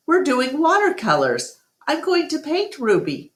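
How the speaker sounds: put-on high, eager girl voice; a girl telling her dad her art plan, high and eager; young girl's voice. A narrator's reading. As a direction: Julia excited about her painting plan. Julia, a girl, talks to her dad.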